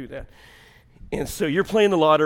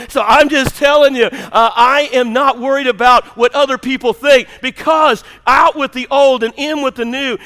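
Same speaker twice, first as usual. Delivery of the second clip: loud, raised-pitch sermon delivery; full-force preaching; 10 semitones higher, 12 dB louder than usual. Real, not acted.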